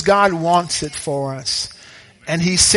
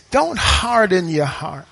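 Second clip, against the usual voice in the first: ordinary talk, nothing marked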